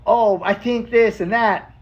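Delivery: high, drawn-out imitation of relatives; slow, high musing; rez-style explaining. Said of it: "this style of communication that I love".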